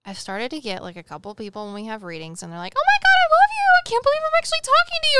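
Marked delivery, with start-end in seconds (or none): 2.8-5.2 very high, loud, fast starstruck squeal; gushing fan. Retold or imitated. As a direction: squeal like a fan meeting her idol. Fan gushing over meeting medium.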